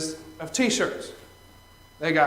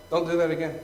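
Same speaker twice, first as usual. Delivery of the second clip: much softer, flat and menacing; quiet warning; 3 semitones lower, 9 dB quieter than usual. Retold or imitated. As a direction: say it low and cold, staring him down. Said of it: "And she scared me".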